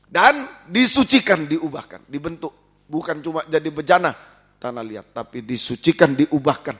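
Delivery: fervent preacher building up; preaching. Acted. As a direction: preach it out with fire. Preaching at full force.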